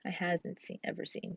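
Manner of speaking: quiet test of the wrong form; trying out "I hasn't seen" under the breath; wrong version, softly. Shown as a demonstration. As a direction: murmur "I hasn't seen" as a trial. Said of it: "That doesn't make sense".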